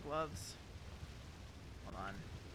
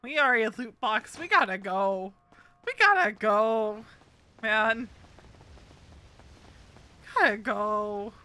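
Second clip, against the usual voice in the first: loud, hurried calls to get moving; urgent panic; 7 dB louder, slower than usual. Real, not acted. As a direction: push everyone out the door, loud and urgent. Panicked escape in the game.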